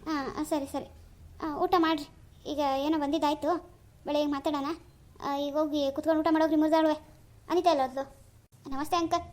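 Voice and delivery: ordinary delivery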